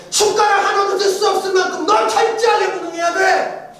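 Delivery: God's voice ringing out high and loud; a loud, high commanding shout; divine command, shouted. Retold or imitated. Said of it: "어떤 마음을 주셨냐면".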